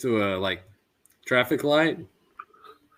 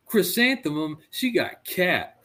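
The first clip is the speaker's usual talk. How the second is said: put-on high, slow, outraged kid voice; mock kid complaining the test was unfair; indignant kid protest; 7 semitones higher, slower than usual. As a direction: stretch it out like a wronged little boy. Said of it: "and he goes".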